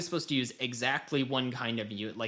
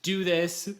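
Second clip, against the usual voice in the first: high, loud bossy game prompt; mock tutorial voice; 8 semitones higher, 5 dB louder, slower than usual. Host imitates the tutorial barking basic controls, high and loud. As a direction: snap out the commands like a pushy tutorial. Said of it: "It was like".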